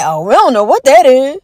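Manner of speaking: lightly voiced baffled officials, rising then fading; hammed-up helpless confusion; mock clueless government. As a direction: play dumb like a shrugging official. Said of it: "They say".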